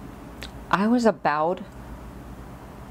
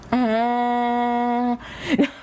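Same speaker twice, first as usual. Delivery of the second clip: long, high, held tongue-out bleat; tongue out harder; 7 semitones higher than usual. Retold or imitated. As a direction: stretch the tongue-out sound as long as possible. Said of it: "I try harder to stick my tongue out".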